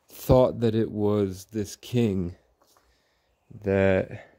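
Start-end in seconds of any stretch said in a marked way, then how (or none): none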